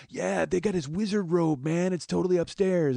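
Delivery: Deadhead dude. Retold or imitated. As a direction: imitate an awed, shambling concert fan. Acts the Deadhead telling of robe.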